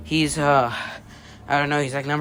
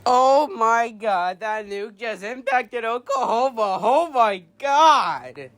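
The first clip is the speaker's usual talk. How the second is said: shaken and scared; 11 semitones higher, 12 dB louder than usual. Acted. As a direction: speak like someone still in shock. Shaken announcer after the villain.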